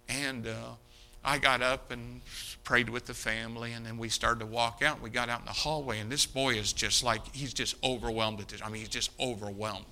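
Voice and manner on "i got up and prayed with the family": usual speaking voice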